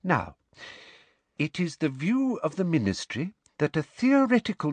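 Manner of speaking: high, sweetly official lecture voice; prim, officious professor. A narrator's reading. Professor giving the class the Ministry's view, prim and sweet.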